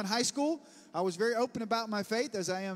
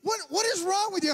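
high, raised-voice mock exasperation; exasperated classmate; 9 semitones higher, 4 dB louder than usual. Preacher voices the classmate questioning his laughter, high mock exasperation. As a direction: imitate someone baffled and put out.

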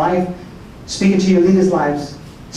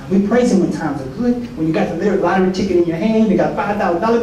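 normal talking voice

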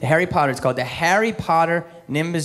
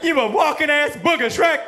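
Eddie Murphy as Donkey; 11 semitones higher than usual. Retold or imitated. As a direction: yell at Shrek like a cussing Donkey. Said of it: "waiting for him to be like".

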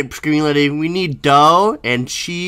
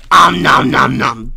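munching noises; 7 dB louder, slower than usual. Pretend. Mock eating a body.

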